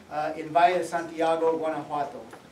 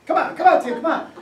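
calling out; 8 semitones higher, 8 dB louder than usual. Retold or imitated. Waving him out of the jeep.

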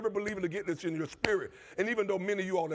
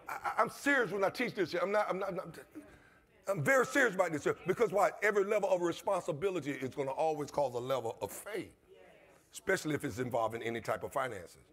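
ordinary talk, nothing marked

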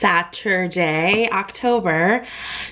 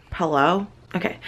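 ordinary talk, nothing marked

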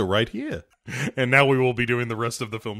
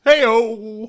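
mock call; 8 semitones higher, 6 dB louder than usual. Comic punchline call.

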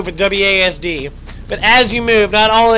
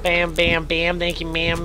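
ordinary delivery

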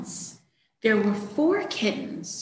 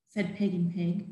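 usual speaking voice

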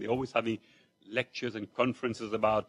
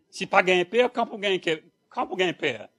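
louder, higher Creole punchline; 7 semitones higher, 11 dB louder than usual. Retold or imitated. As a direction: deliver the joke line with relish. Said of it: "It says".

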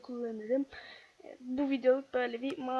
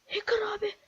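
stunned whisper; 7 semitones higher than usual. Real, not acted. Opponent seems a hacker.

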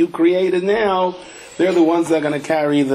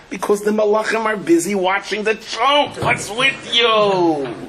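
high, slow, mocking old woman; old Baba voice; 8 semitones higher than usual. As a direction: drag it out like a scolding grandmother.

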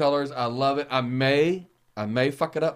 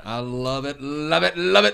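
building enthusiasm; 3 dB louder, slower than usual. Real, not acted. Gushes about the new studio.

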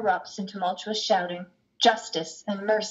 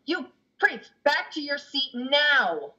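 judge snaps much higher, slow on the last words; stern order; 8 semitones higher, slower than usual. Acted. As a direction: put the priest in his place. Judge orders the priest back.

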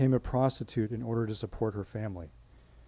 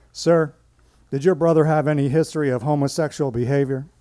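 blunt; 4 semitones higher, 8 dB louder than usual. Retold or imitated. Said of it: "might not diffuse such concerns so skillfully".

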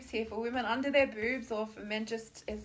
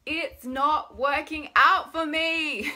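loud, high, drawn-out whine; exaggerated whining complaint; very whiny; 5 semitones higher, 8 dB louder, much slower than usual. Shown as a demonstration. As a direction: whine it out slowly, pitched up. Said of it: "in a very whiny voice".

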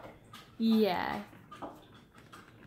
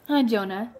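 ordinary delivery

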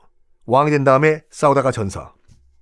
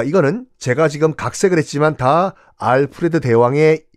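ordinary talk

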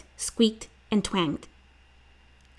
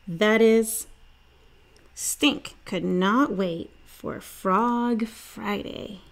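plain reading voice

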